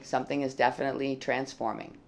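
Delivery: ordinary talk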